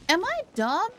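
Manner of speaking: usual speaking voice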